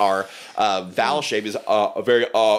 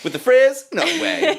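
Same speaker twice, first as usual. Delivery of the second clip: excited; 6 dB louder than usual. Real, not acted. Excited joking.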